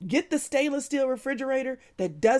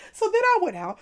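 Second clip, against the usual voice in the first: So then I went out said through laughter, higher and louder; laughing; 9 semitones higher, 4 dB louder than usual. Real, not acted. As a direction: repeat it while still laughing.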